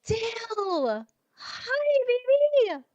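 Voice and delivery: high, thrilled squeal of hello; excited greeting. Real, not acted. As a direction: greet a beloved friend in high-pitched delight. Spots a dear friend arriving.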